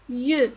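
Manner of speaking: "ye" as one loud gliding syllable; prononciation démontrée. Shown as a demonstration. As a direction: model "ye" with a rounded eu vowel. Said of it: "ça se prononce". (« ye » articulé fort, comme yeu)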